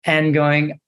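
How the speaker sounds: usual speaking voice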